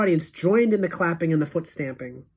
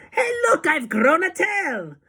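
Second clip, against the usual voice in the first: much higher startled cry; mock alarmed yell; 11 semitones higher, 4 dB louder than usual. Witch voices a schoolgirl in her song, mock alarmed yell. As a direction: shriek in shock. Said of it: "She yells".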